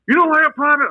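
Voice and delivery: mock drill sergeant shouting at a private; drill sergeant yell. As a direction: bark it down at the recruit. Drill sergeant yelling at recruit.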